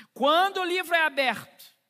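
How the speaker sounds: high, loud preaching call; proclaiming. Acted. Preacher to church, loud proclamation. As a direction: ring it out like a proclamation.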